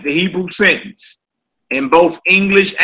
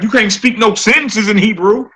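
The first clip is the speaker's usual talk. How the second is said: lightly voiced doubter, pitched higher; mock doubter; 6 semitones higher than usual. Teacher imitating somebody who doubts the students, mildly mocking. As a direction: imitate someone challenging you, pitch lifted. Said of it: "somebody be like".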